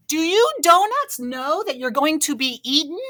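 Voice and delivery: loud, high call across the room; yelling. A narrator's reading. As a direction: yell to the back. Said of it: "The baker yelled to the other donuts".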